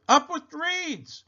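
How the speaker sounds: very high, drawn-out hoot; delighted duckbill chant. A narrator's reading. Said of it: "And they hooted".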